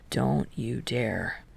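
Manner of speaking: slow, low warning. A narrator's reading. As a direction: draw it out as a quiet threat. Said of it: "Kei warns".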